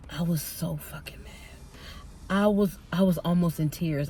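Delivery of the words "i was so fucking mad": hushed, whispered venting; seething whisper. Real, not acted. Repeating to viewers how mad the coworker made her, seething whisper. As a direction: drop to a whisper, still mad.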